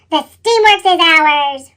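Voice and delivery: loud, slow villain roar. A narrator's reading. Diesel ten takes over the steamworks, roaring. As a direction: claim it with a slow bellow. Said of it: "roared Diesel ten".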